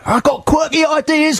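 high mocking Cockney chappie; quirky Cockney video man voice; Cockney pop director. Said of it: "That's how I normally talk".

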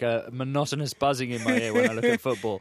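usual speaking voice

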